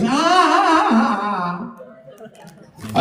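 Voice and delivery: high, forced ha ha that fades out; hollow put-on audience laugh; fake laugh. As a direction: pretend to find it funny through clenched resentment. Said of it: "sitting in the audience".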